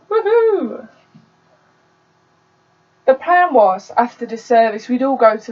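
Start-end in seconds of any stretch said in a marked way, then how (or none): 0.1-0.9 cheer. Acted. Cheering the new part.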